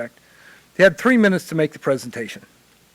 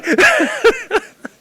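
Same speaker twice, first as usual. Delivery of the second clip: real laugh; 7 dB louder than usual. Real, not acted. Laughing after praising John.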